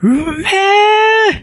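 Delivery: long drawn-out relish; savoring delight. Acted. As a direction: stretch it out, tasting every bit. First bite of eel. (ゆっくりと味わう感嘆)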